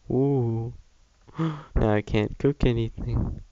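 ordinary delivery

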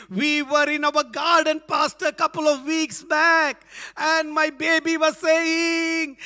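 mother recounting to pastor, excited; excited mom telling. Retold or imitated. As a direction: spill the story to the pastor. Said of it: "Then he says".

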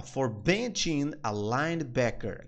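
very slow, careful phrase; slow demo. Shown as a demonstration. Teacher quoting the show for students, very slow. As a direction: spell it out for learners. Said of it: "Então ele fala".

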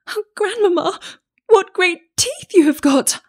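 put-on very high, alarmed-sounding child's exclamation; amazed little girl. A narrator's reading. Child noticing disguised grandmother's teeth, high astonished voice. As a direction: gasp it out high in growing wonder. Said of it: "being greatly amazed".

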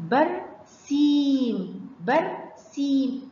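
"برسيم" split as بر, then long سيم; drawn-out syllables in "برسيم"; long vowel. Shown as a demonstration. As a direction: hold the ee of "برسيم" very slowly. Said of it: "سامعين صوت المد بالياء مع السين".

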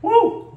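high, loud burning whoop; pained whoop. Real, not acted. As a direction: yelp as the heat hits. Spicy heat hitting hard.

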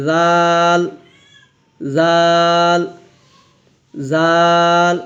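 "জাল" with a thin, light z and long open aa; thin sound. Shown as a demonstration. Teacher leading repeats of the thin letter, long light "জাল". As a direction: draw out "জাল" thinly, unrounded. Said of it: "পাতলা আওয়াজ হবে".

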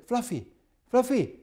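high-pitched call for a pet; calling the dog. Retold or imitated. Lady calling her dog at the seance, high-pitched calling. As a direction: call the name high, falling at the end.

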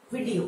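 "वीडियो" quick and low as VID-ee-o; short-vowel pronunciation. Shown as a demonstration. Teacher reading the spelling back, short-vowel pronunciation. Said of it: "वी में छोटी की मात्रा".

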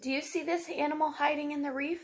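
ordinary talk, nothing marked

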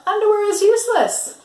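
high, loud triumphant shout; yell. Acted. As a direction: bellow it like you've won the argument. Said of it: "yelled Bismarck".

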